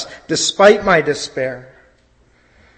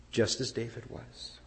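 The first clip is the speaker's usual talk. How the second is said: soft murmur; 4 semitones lower, 15 dB quieter than usual. Acted. Repeated phrase, first very soft.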